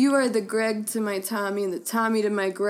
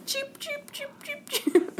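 high, soft chirping cheeps; chick noise; 6 dB quieter, slower than usual. Retold or imitated. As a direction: peep like a baby chick. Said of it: "them doing the chick noise".

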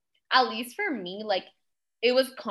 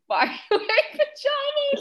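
much higher, fast disbelieving mock question; incredulous quote; 14 semitones higher, faster than usual. Friend repeating the interviewer's rude question to Risa, incredulous. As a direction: replay the question in shocked disbelief.

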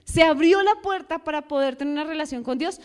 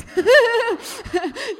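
high burst of laughter; 8 semitones higher than usual. Real, not acted. Laughs at the teachers.

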